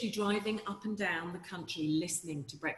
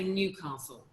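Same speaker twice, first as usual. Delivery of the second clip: Queen's English NewCAHstle with long ah; Received Pronunciation voiceover "Newcastle"; RP accent; slower than usual. Shown as a demonstration. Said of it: "that's very R P".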